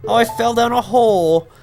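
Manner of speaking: very high, loud squealing princess; bratty princess. Acted. Princess Ruto falling down a hole, squealing high and loud. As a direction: squeal in mock alarm as the princess.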